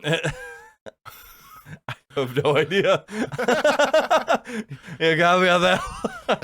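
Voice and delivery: high, loud laughter through the words; laughing helplessly. Real, not acted. Friend stumped by the musk ox question, laughing through the answer. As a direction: crack up while admitting defeat.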